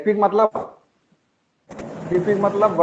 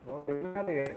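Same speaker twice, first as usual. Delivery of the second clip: faint call audio; 5 semitones lower, 17 dB quieter than usual. Real, not acted. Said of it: "कट रही है sir आवाज".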